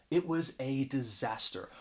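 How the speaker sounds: emphasize "a disaster" with "a" said "ay"; emphatic article. Shown as a demonstration. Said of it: "I'm trying to emphasize".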